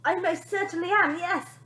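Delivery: high, hesitant voice; timid father edging away; timid reply. Acted. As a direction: answer timidly. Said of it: "replied the timid little man".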